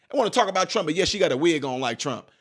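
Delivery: ordinary delivery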